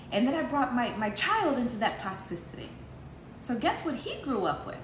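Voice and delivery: ordinary delivery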